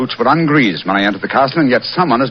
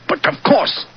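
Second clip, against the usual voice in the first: high, breathy burst of discovery; the penny drops, let it burst out; sudden breathless eureka; sudden realization; 11 semitones higher, slower than usual. Acted. Holmes suddenly sees the answer.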